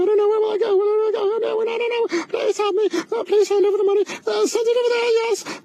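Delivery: high, whiny hammed-up crying; mock sobbing plea. Comedian mocking a grifter begging for donations, whiny crying. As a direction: beg melodramatically for money.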